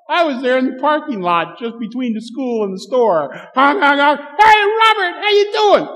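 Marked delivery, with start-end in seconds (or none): 3.5-4.2 loud, high honk-honk sounds; imitated car horn. Dad honking at his son outside school, mock horn. 4.2-5.9 high, loud, cheery call across a lot. Retold or imitated. Dad calling his son from the parking lot, loud high call.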